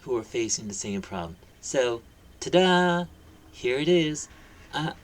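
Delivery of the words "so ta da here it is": high, slow ta-da, louder; presenting with a flourish; excited reveal. Real, not acted. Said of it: "I am so excited to share".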